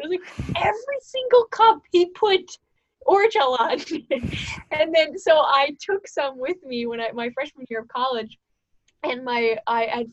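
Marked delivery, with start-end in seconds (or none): 0.6-3.8 high, loud exclamation; mock outrage. Speaker exclaiming at her brother's prank, high and loud. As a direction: stretch out the disbelief.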